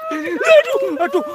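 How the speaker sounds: frightened shriek. Real, not acted. Startled by ghost in the dark.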